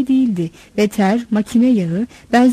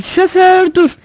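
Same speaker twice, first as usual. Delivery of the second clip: high, loud call to stop; shouting passenger; 9 semitones higher, 6 dB louder, much slower than usual. A narrator's reading. Woman passenger yelling to the driver, high acted shout. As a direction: call out loudly to halt the driver. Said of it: "bağırır".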